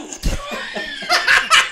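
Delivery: high loud burst of laughter; loud laughing burst. Real, not acted.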